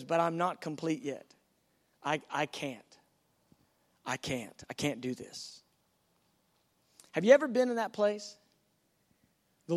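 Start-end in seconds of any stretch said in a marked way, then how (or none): 0.0-5.6 sinking from soft to much softer and lower, giving up; a defeated voice dwindling toward nothing; fading despair. Acted. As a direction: let each I can't shrink away. Bride doubts herself, fading.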